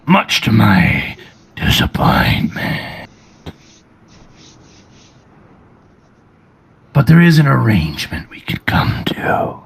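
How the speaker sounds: low, rasping dragonborn menace; deep gravelly voice. Acted. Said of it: "A deep, gravelly voice".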